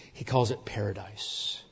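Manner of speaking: a reverent hush on paradise; awed whisper. Real, not acted. Preacher to congregation, whispering the name of heaven. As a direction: breathe the word out in a whisper.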